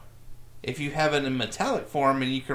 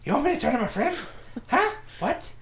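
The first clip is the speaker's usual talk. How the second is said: much higher, fast indignant tough guy; 13 semitones higher than usual. Acted.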